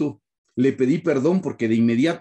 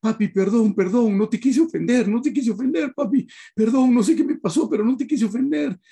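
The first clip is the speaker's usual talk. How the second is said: high, pleading apology to a small son; 10 semitones higher than usual. Retold or imitated. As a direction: beg forgiveness, voice lifted high. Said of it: "abrazarlo y decir".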